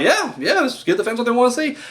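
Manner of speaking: mock eager fan. Himself as a gullible fan.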